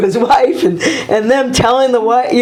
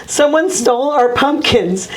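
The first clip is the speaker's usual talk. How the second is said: higher-pitched upset wife, lightly acted; mock distraught; 7 semitones higher than usual. Wife reporting stolen pumpkins to her coach husband, mock distraught. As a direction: imitate a wronged wife in a raised pitch. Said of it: "Mary Lou's distraught. Like, she's upset".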